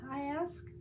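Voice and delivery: plain reading voice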